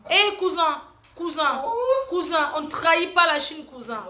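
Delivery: hammed-up wesh cousin talk from the projects; Paris cité slang. Mother on live imitating Paris housing-estate youth in banlieue slang. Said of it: "je vais parler comme les wesh".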